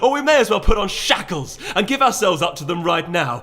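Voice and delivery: high, loud impassioned ultimatum; Celerity declaiming to the council, high and loud; dramatic declaration. A narrator's reading. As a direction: point and proclaim to the room. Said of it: "pointed dramatically".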